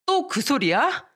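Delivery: fed-up husband. Retold or imitated. Husband brushing off his wife's nagging, slow irritated groan. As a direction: sigh it out like he's heard it a hundred times.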